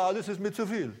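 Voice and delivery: whiny mock complaint; mock whining. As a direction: mimic a moaning believer. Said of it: "Wir sind immer".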